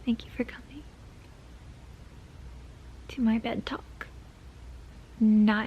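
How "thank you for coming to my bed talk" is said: whisper. Real, not acted. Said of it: "It's just a play on a ted Talk".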